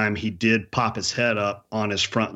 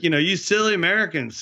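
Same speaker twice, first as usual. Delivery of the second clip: put-on higher-pitched Canadian bush accent; backcountry Canadian accent; 7 semitones higher than usual. Owner questions hunter about missed shot, backcountry Canadian accent. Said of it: "you're doing the accent".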